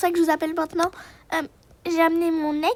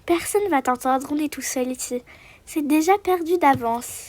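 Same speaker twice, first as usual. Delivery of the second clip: ordinary talk, nothing marked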